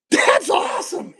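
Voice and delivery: put-on high, loud kid's shout; thrilled kid. Kid grabbing the candy bowl, shouting high and loud. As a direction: yell it like a delighted eight-year-old. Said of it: "He goes".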